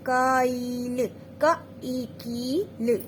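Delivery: sounds pieced together very slowly; धीरे-धीरे अक्षर जोड़कर; slow spelling out. Shown as a demonstration. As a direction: build the word syllable by syllable. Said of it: "जोड़ के करके कराना है".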